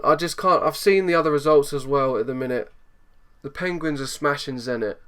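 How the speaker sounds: ordinary talk, nothing marked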